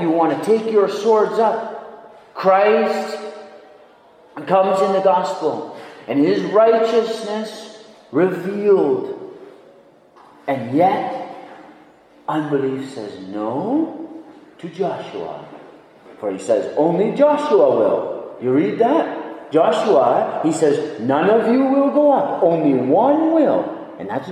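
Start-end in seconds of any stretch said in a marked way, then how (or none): 0.0-9.3 loud, high pulpit proclamation; forceful preaching. Real, not acted. Preacher proclaiming Christ in the gospel, full force. 16.8-23.6 high, loud pulpit decree; forceful preaching. Acted. Preacher declaring God's word on Joshua, forceful and raised.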